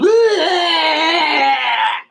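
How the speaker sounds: high screaming laugh. Real, not acted. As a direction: shriek with laughter. Loud laugh.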